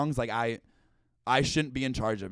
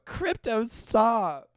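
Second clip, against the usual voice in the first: mock scolding dog; 9 semitones higher, 4 dB louder, much slower than usual. Supergirl scolding her dog.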